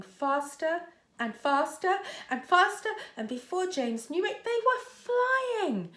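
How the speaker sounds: excited build. A narrator's reading. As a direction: build the excitement until they take off. Snowman speeds up and takes off.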